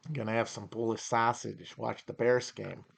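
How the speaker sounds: put-on understated Chicago accent, softly delivered. Streamer imitates a Chicagoan planning game day, Chicago accent. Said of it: "Oh, the Chicago accent".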